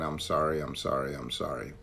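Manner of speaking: low, trailing repeated apology; mock automatic sorry, sinking away; rote apology. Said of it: "always saying".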